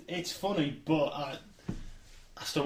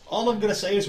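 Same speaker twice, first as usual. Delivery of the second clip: usual speaking voice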